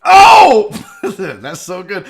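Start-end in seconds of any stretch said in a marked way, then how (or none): 0.0-0.7 loud, high burst of "oh"; shocked shout. Real, not acted. Host watching a crash clip, shocked shout. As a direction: cry out at a painful hit.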